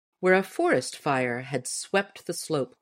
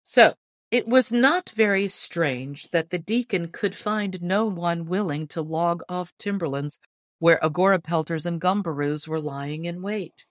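ordinary delivery, nothing marked

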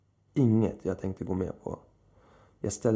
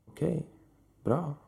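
cool, higher-pitched brief approval; chilly; 7 semitones higher than usual. A narrator's reading. Woman approves as the man returns with the knife, chilly. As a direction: acknowledge it coolly, no warmth. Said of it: "sa hon kyligt".

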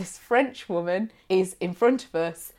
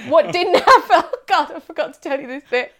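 usual speaking voice

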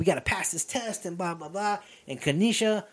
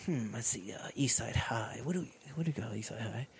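much softer, lower, breathy musing to oneself; mock pondering; 9 semitones lower, 14 dB quieter than usual. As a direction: muse it quietly like deciding a name. District mock-pondering mascot names.

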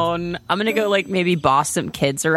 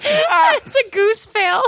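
high squeaky voice; 3 dB louder, much slower than usual. Real, not acted. Reads out a failed roll.

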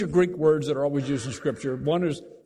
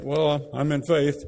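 mock pious believer. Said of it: "And they say it like that".

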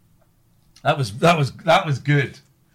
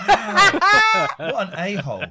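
laughing; 6 semitones higher, 7 dB louder, slower than usual. Real, not acted. Reacting to the Trump quote.

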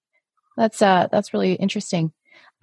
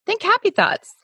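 much higher, mock-cheerful coaxing; chirpy vocal teacher; 11 semitones higher than usual. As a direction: imitate the teacher's high, perky encouragement. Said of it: "but she's like".

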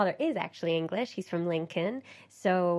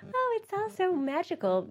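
high, airy, sing-song gush; gushing mock dreamy; 12 semitones higher than usual. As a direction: gush like a starry-eyed fan. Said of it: "I don't mean that to sound like".

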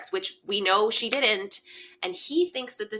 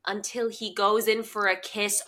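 normal talking voice

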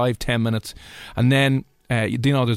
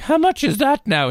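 put-on much higher, slow old lady voice; imitating elderly lady; 3 dB louder, slower than usual. Old lady asking the young lad the coffee price, high and slow. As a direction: ask it up high like a fussy old woman. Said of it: "And she's like".

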